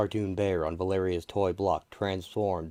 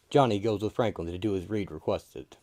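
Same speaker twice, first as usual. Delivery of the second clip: usual speaking voice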